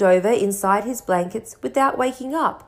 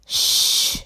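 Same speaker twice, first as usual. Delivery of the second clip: sharp unvoiced hiss; hissed shh. A narrator's reading. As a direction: hush him with a hiss. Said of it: "hissed Professor McGonagall".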